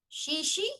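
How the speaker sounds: high, soft, tentative "Xi xi" rising like a question; botched Mandarin thanks. Acted. Trying to thank a Chinese official, "Xi xi" mispronounced and rising. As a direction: try "Xi xi" unsurely, tone going up. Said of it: "still not the right thanks".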